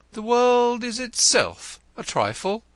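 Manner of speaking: pensive poet. A narrator's reading. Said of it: "said the pensive poet".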